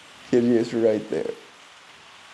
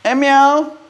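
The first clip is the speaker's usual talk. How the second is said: loud high call to the cat; 14 semitones higher, 6 dB louder than usual. Real, not acted. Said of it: "me calling for the cat".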